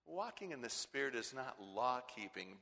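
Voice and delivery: usual speaking voice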